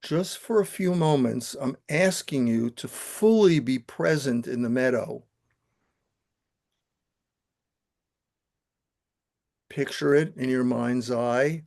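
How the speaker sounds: calm, steady guiding voice, unhurried; guided meditation. Acted. Teacher guiding the class through the visualization, calm meditation voice. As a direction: lead the room gently into stillness.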